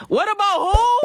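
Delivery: high, loud disbelieving squeal; shocked squeak. Pretend. Shocked mock question.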